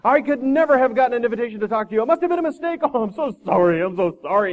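high, loud, pleading self-abasement; hammed-up abject wail, tumbling into apology; grovelling. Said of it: "the man went right on groveling".

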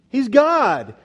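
high, loud cry falling on "God"; emphatic exclamation. Real, not acted. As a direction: proclaim "God" from high up, loudly. How did he know?.

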